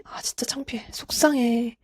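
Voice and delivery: soft whispered admission of embarrassment; whispering hurt feelings privately; whispered self-talk. Retold or imitated. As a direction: breathe it out to yourself, ashamed. Voicing own embarrassment, self-talk.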